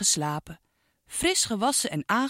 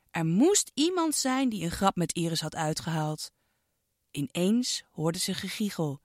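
ordinary delivery

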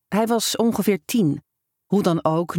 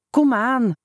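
coaxing; 6 semitones higher than usual. A narrator's reading. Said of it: "zei ik".

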